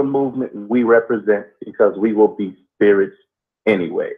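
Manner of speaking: slow, deliberate oration with long pauses; spoken-word pondering. Acted. Co-host expounds on history and time, slow deliberate oration. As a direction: weigh each phrase, pausing heavily between them. Improvised philosophical monologue on history.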